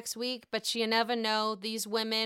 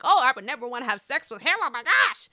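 fast, loud, put-on scandalized Karen; mock prim Karen; 7 dB louder, faster than usual. Karen answering Gizelle, put-on scandalized voice. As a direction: toss it off like a prim grande dame. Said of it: "Karen's like".

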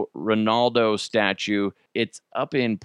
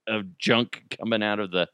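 usual speaking voice

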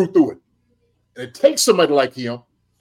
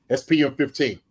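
ordinary talk, nothing marked

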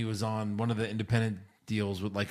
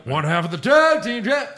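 wrestler catchphrase; 9 semitones higher, 8 dB louder than usual. Retold or imitated.

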